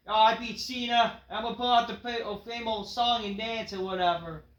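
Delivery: brash wrestler cutting a promo, lightly voiced; L A Knight impression. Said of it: "L A Knight says".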